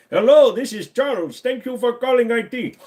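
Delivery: high, loud put-on Indian accent, IT helpdesk man; hammed-up Indian accent answering the IT line. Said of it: "proper Indian accent".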